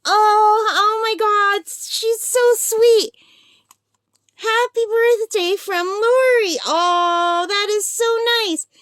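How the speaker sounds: high-pitched, drawn-out gushing; touched delight. Real, not acted. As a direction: gush over a friend's surprise gift, slowly. Touched by her friend's birthday gift.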